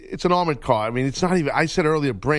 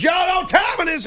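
hype man; 14 semitones higher, 3 dB louder than usual. Retold or imitated.

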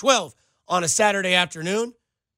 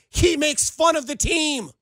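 exasperated outburst; 7 semitones higher, slower than usual. Real, not acted. Host pushing back on the texter, exasperated shout. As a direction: throw up your hands and protest the point. Defending himself against angry texter.

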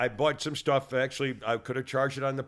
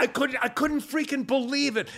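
high-pitched, stammering incredulity; can't get over it, voice climbing; exasperated disbelief; 10 semitones higher than usual. Real, not acted. Host recounting a baffled cashier, exasperated disbelief.

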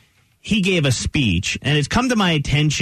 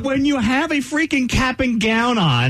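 graduation speaker; 9 semitones higher than usual. Pretend.

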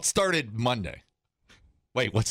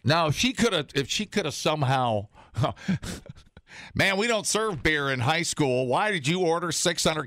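scolding principal; 7 semitones higher than usual. Retold or imitated. Mocking principal scolding student.

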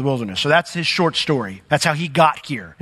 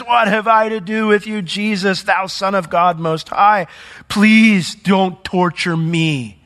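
raised pleading cry, higher pitched; loud cry; 4 semitones higher, slower than usual. Acted. Possessed man begging Jesus not to torture him, loud cry. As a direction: cry out, dragging out the final plea. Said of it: "and then with a loud voice".

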